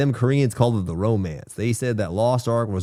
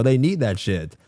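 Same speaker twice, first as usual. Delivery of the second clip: normal talking voice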